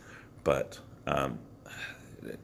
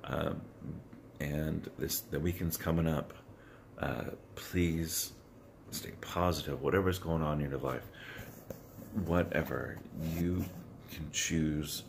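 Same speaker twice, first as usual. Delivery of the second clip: normal talking voice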